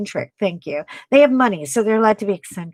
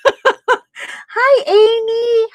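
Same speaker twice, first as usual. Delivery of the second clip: laughing hello; 13 semitones higher, 4 dB louder, much slower than usual. Real, not acted. Greeting viewer while laughing.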